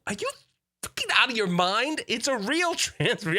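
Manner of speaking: high, disbelieving outburst; can't believe they don't care; incredulous outburst. Retold or imitated. Dad to his bored kids, high and incredulous.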